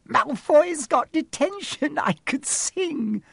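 very high and slow, giddy delight; gleeful. Acted. Hermione dancing a jig on the tower, giddy. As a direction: squeal it out, almost singing.

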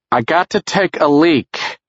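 pained, slow and high; strained urgency. Acted. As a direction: push through the pain. Jerry in pain, urgent.

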